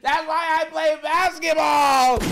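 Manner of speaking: loud laughing nah, drawn out and slow; laughing refusal. Retold or imitated. Basketball player after one hit, laughing loudly. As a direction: laugh off the idea and shout it. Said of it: "they're gonna be like".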